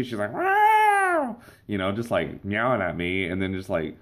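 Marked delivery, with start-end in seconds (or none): 0.3-1.4 loud, high-pitched mock cat yowl; angry cat meow. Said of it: "she gets mad at me".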